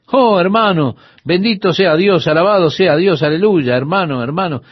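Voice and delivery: high, run-together mock praise; imitating rapid talkers. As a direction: imitate men gushing pious phrases without a break. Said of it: "y hablaban tan rápido".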